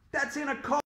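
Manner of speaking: high-pitched, indignant outcry; shouted protest. A narrator's reading. Boy argues with dentist over seatbelt, shouting. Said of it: "I shouted".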